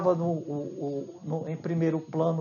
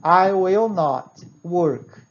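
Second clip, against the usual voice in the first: slow full form; 4 dB louder, much slower than usual. Shown as a demonstration. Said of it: "a palavra not completa".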